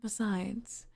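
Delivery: normal talking voice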